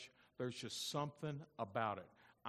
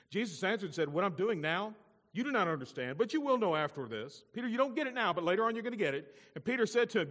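raised, louder pulpit retelling; forceful preaching; 9 semitones higher, 10 dB louder than usual. Acted. Preacher retelling Jesus answering Peter, forceful and raised. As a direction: build the Bible exchange with rising force.

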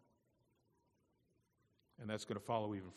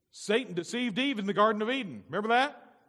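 booming high preacher shout; preaching at full force; 11 semitones higher, 13 dB louder than usual. Acted. Preacher booming the story to the congregation, loud and high. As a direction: thunder it from the pulpit.

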